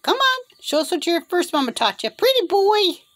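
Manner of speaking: high, sing-song encouragement; coaxing pet talk. Real, not acted. Owner asking bird to show what mama taught, high coaxing. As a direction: egg the bird on, voice lifting high.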